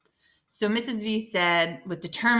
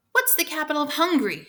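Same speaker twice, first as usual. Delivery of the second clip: demanding; 7 semitones higher, 4 dB louder, faster than usual. A narrator's reading. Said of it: "she demanded".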